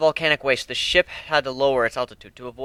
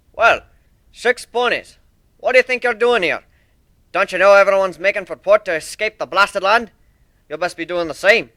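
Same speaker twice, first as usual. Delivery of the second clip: loud, higher Scottish calling; Scottish minotaur; 6 semitones higher, 6 dB louder than usual. A narrator's reading. Hidden minotaur questions the ponies, loud Scottish. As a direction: hail the strangers in a broad Scots voice. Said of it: "distinctly Scottish voice".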